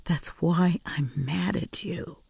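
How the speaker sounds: soft, slow, whispered reproach; gently cross with her; hushed scolding. A narrator's reading. Mouse scolding child gently.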